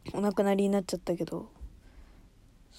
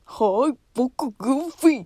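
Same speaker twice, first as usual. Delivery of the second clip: slow, put-on Goofy voice; bad Goofy impression; 5 semitones higher, 4 dB louder, slower than usual. Showing her poor Goofy impression. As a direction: drawl it out like Goofy, badly. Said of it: "似てないグーフィー".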